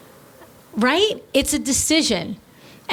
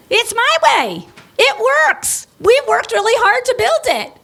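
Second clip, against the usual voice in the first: indignant scolding; 4 semitones higher than usual. Acted. Veteran business owner scolding an upstart, building to an outraged rant. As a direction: lecture the upstart like a wounded veteran, rising to fury.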